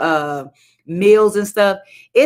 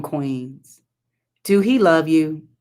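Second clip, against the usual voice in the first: ordinary talk, nothing marked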